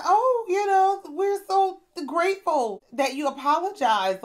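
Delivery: high, loud, sugary mock gratitude; mocking the group's gushing thanks. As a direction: ham up everyone's gushing thanks. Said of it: "everybody's like".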